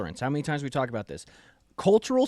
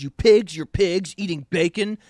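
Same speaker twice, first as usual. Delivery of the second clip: mock yell; 2 semitones higher, 4 dB louder than usual.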